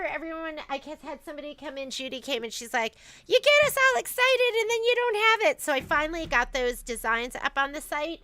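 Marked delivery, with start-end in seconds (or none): 3.3-5.6 higher, louder imitated customer protest; hammed-up exasperated whine at being teased; mock complaint. Judy comes in to complain about missing designs, mock complaint.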